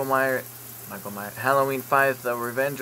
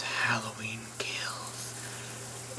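hushed, very slow whisper; awed whisper; 5 semitones lower, 12 dB quieter, much slower than usual. Real, not acted. Vlogger whispers the movie title he found. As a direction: breathe the title out in awe. Awed reveal of new mask.

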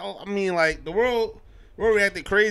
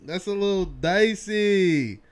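mock wary reaction; 4 semitones higher, 5 dB louder than usual. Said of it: "I was just sitting over here like".

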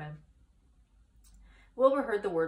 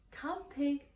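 coaxing farmer; 8 semitones higher than usual. A narrator's reading. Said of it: "said Mister Zuckerman".

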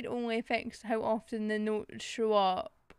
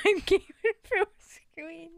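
higher, louder, breaking into laughter; real laughing; 7 semitones higher, 5 dB louder than usual. Real, not acted. Player tickled by their own garbled words, laughing. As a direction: force the words out through a laugh.

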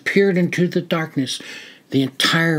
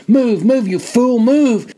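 higher, louder urgent push; urgent self-command; 7 semitones higher, 4 dB louder, slower than usual. Acted. Soldier urging himself in panic to move, loud. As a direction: drive yourself to move.

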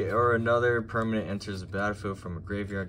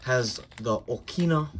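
normal talking voice

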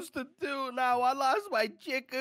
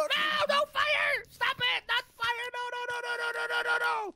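panicked pleading; 8 semitones higher than usual. Real, not acted. His base set on fire.

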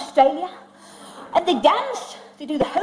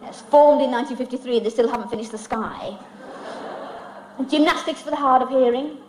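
normal talking voice

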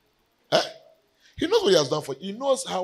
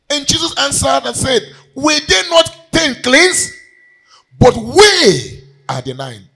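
loud, high preaching voice; 11 semitones higher, 11 dB louder than usual. Acted. Preacher voices Jesus asking for the nine, loud.

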